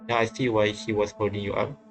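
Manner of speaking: normal talking voice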